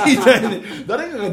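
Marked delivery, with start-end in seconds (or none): 0.1-0.2 high, exaggerated "ii" mimicking Tokyo speech; hammed-up Tokyo vowel; mock Tokyo "ii". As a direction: push the lower lip out, mocking Tokyo talk. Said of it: "下唇がニューって出てくる".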